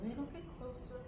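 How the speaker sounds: very soft, spaced out; hushed baiting suspense. Retold or imitated. Comic lying in wait for God, hushed suspense. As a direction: tease it out barely aloud.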